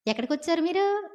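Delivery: high-pitched prying question; nosy townsman. Retold or imitated. Townsman prying at Lot's guests, high and nosy. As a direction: ask the strangers like a busybody local.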